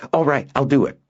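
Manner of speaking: put-on high, agreeing boy's voice; eager boy. Boy agreeing to run the errand, eager. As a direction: jump at it.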